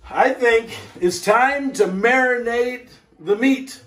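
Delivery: high, lifted cheerful rising line; bright announcement. Acted. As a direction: sing it out brightly with a rise at the end. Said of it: "Actually, you know what?".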